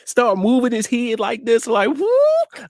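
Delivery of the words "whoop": very high comic hoot; sudden high whooing sound; imitated whoop. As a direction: mimic his noise with a falsetto whoop. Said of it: "like that".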